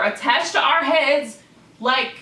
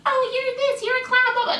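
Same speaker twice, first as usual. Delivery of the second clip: much higher mocking nag voice; mocking critics. Imitating haters nitpicking her, high mocking voice. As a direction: whine it like a nitpicker. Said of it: "You can talk all this smack about me".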